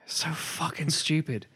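slow exasperated whisper. Real, not acted. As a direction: whisper it in disgust. Exasperated aside.

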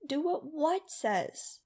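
put-on a young boy pressing for an answer; demanding kid. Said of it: "the Gasman demanded".

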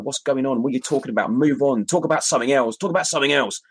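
lightly voiced impatient Otis; imitating Otis. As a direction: voice Otis nagging to move on. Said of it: "He's like".

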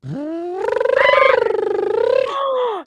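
a long high vocal slide; high pitch glide exercise; high warm-up siren gasp. Shown as a demonstration. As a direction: swoop the voice way up on a gasp. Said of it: "but going like".

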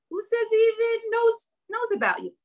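high sneering inner doubter; mocking inner voice. A mother voices the taunting doubt in her head, high and sneering.

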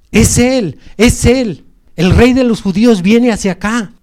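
high-pitched, loud heralding cries; crowd shouting. Acted. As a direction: shout out that someone great is coming. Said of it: "Otros gritaban".

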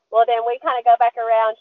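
normal talking voice

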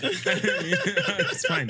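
squeaky high "Yeah" breaking into laughter; giggling high reply; laughing through it. Retold or imitated. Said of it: "And so I was like".

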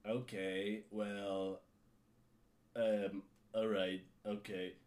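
low, drawn-out, put-upon customer service voice; mock an annoyed agent who can't be bothered; bored bitchy tone. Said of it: "this woman just had this, like, tone on her".

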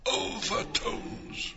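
slow ghostly whisper. Acted. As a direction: breathe it slowly.

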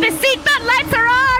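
high loud attendant cry; flight attendant. Acted. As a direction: shout it high and shrill.

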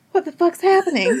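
shocked at the violence in the movie. Retold or imitated. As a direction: react like you just jumped. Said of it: "I was like".

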